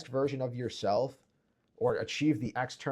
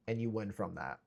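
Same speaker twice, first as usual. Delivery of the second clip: usual speaking voice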